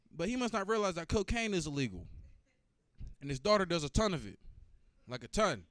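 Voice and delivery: usual speaking voice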